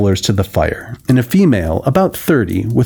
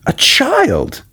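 astonished; 7 semitones higher, slower than usual. A narrator's reading. Margaret astonished at the child.

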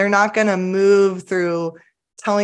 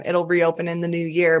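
ordinary talk, nothing marked

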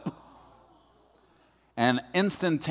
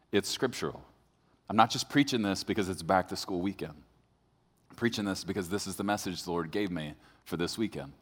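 lower, softer, serious sermon voice; earnest preaching; 6 semitones lower, 6 dB quieter than usual. Acted. Pastor telling congregation he refuses to lose children, soft earnest preaching. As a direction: drop into solemn conviction.

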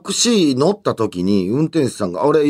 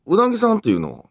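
lightly imitated regional Japanese accent, higher pitch; 地方訛りの運転手; 8 semitones higher than usual. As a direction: ask it as an older driver with a slight regional accent. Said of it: "りが入ってる". (少し訛ったおっさん運転手の真似)

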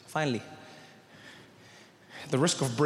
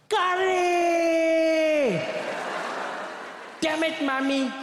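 high, long-drawn scream of outrage; top-of-lungs scream; 11 semitones higher, much slower than usual. Retold or imitated. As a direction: scream the first word high and drag it out, then curse. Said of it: "he'd scream at the top of his lungs".

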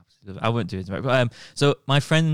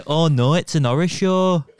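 loud, higher Scouse impression; Carragher; 3 semitones higher, 6 dB louder than usual. Shown as a demonstration. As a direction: belt it out in imitation Scouse. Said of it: "That was actually quite close".

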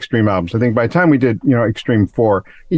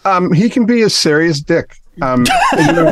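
laughing; 7 semitones higher than usual. Real, not acted. Joking about his bandmate.

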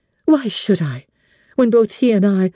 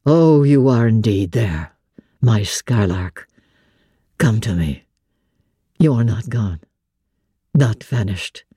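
a lower, tender blind gentleman, moved; low male voice for the master; 8 semitones lower than usual. A narrator's reading. Blind master to his returned Jane, low and tender.

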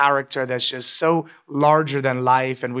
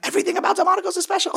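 much higher, fast, mock-outraged squeal; indignant protest; much faster than usual. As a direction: squeal it in disbelief, fast and very high. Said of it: "I'm like".

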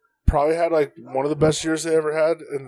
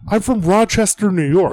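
louder flat reply; 6 semitones higher, 6 dB louder than usual. Retold or imitated. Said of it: "his response was always".

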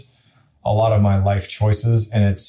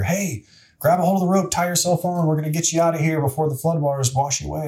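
helicopter loudspeaker call; 6 semitones higher than usual. Retold or imitated. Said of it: "on the loudspeaker".

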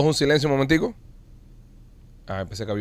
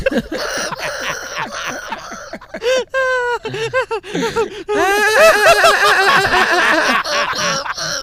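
long high burst of real laughter; loud helpless laughter; big laugh; 3 dB louder than usual. Real, not acted. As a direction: laugh hard at the trap. Friend caught in a joke.

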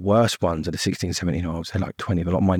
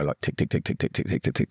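soft whispered tick tick, like a clock; ticking in the head; 3 semitones lower, 4 dB quieter than usual. Retold or imitated. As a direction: breathe the ticks out unvoiced, like a clock inside his head. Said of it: "my head would go".

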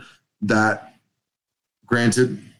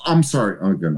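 ordinary talk

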